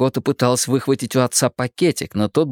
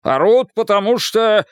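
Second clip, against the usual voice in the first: higher, louder angry outburst; raised voice; 10 semitones higher, 4 dB louder than usual. A narrator's reading. Husband argues back at wife, raising his voice. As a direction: start shouting back, break off mid-sentence. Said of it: "Джерри повысил голос".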